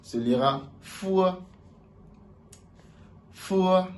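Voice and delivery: "Fua" said high, slow and clear; Chinese letter F. Shown as a demonstration. Teacher drilling the Chinese letter name for students, high and clear. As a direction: model "Fua" slowly and high. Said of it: "Le F".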